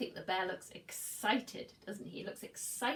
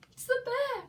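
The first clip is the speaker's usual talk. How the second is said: high, loud panicked cry; frightened cry; 10 dB louder than usual. Acted. Eddie crying out in fear at the bear. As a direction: cry out in alarm, pitched way up. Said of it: "cried Eddie".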